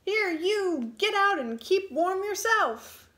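put-on high, loud shout at the boy. A narrator's reading. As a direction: yell him out of bed. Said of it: "shouted the little man".

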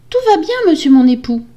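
very high, loud, dutiful wife voice; meek wife. Acted. Wife reassuring her husband, high meek voice. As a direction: reassure him in a high wifely voice.

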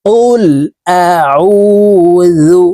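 slow melodic chanted recitation; Quranic recitation. Acted. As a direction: intone it slowly like tilawat. (ধীর সুরেলা কুরআন তেলাওয়াত)

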